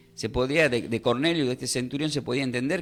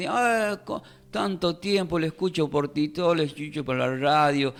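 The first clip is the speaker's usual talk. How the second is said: gushing follower; 2 semitones higher than usual. Acted. Preacher playing a follower who meets him at last, mock gushing. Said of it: "algún miembro de mi, de, mi ministerio venga".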